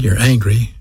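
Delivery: ordinary delivery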